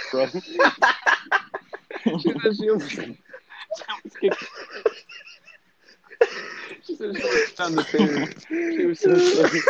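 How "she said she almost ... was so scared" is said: high, helpless laughter through the words; laughing hard. Real, not acted. Telling friends how scared the aunt was, cracking up. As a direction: barely get it out for laughing.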